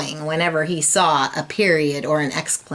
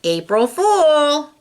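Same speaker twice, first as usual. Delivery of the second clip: teasing teacher; 9 semitones higher, 4 dB louder, slower than usual. A narrator's reading. Teacher springs her joke.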